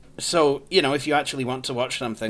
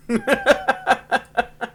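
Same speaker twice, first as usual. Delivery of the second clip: high, loud burst of laughter; laughing; 12 semitones higher, 7 dB louder than usual. Real, not acted. User tickled by the Finder program's name, laughing loudly. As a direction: crack up in a long cackling run.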